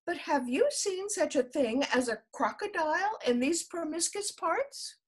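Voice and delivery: high, polite little voice; polite elephant's child. A narrator's reading. The elephant's child asks the snake, high and polite.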